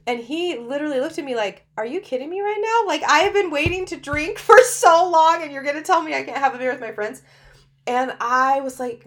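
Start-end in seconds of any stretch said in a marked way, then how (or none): 1.8-7.2 mock-outraged husband, high and loud; incredulous protest. Husband protesting to wife about beer, mock outrage. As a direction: imitate an exasperated outburst, pitch jumping up.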